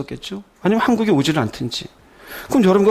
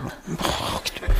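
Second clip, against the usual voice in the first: hushed prayer; 9 dB quieter, much slower than usual. Retold or imitated. Pastor mimics praying.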